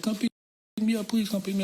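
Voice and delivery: high, pleading, mock-tearful plea; imitating crying girl. Girl crying on the phone, begging to be picked up, high and pleading. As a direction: whimper it like she's been crying. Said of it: "She crying and shit".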